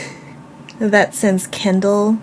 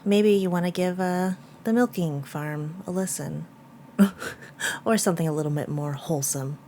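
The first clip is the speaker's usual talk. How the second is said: quiet voiceover; 8 dB quieter than usual. Real, not acted. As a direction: murmur it like a quick editing note. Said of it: "Editing mama here".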